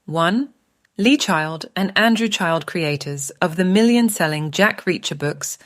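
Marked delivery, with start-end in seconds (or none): none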